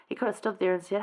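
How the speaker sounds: ordinary talk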